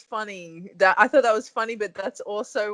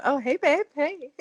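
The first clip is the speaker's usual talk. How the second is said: higher-pitched mock southern surfer; 9 semitones higher than usual. Co-host teasing the host's accent, mock southern surfer voice. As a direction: playfully put on a southern surfer greeting. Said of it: "He sounds like a southern surfer".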